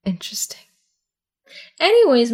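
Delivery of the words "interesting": dry whisper. Real, not acted. Dry reaction to friend's idea.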